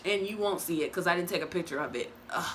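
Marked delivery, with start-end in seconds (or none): none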